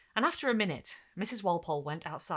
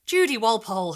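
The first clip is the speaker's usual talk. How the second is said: stern rebuke; 4 semitones higher, 11 dB louder than usual. A narrator's reading. Mother rebuking daughter.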